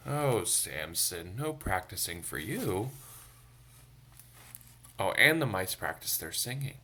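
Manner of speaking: plain reading voice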